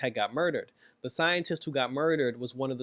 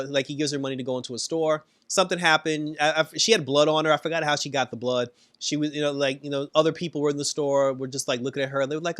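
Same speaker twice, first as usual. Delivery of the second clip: usual speaking voice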